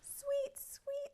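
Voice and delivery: high chirping tweet; bird chirp. Acted. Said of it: "said Bird".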